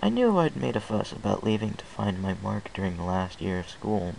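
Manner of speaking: usual speaking voice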